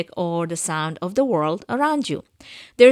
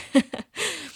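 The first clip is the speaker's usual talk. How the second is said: light laugh; 9 semitones higher than usual. Real, not acted. Laughs at her own joke.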